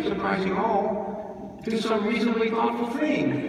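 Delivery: can't stop himself, rushing on in a high eager voice; breathless runaway tribute. A narrator's reading. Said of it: "breathless, ... Can't seem to stop self. Lunges ahead".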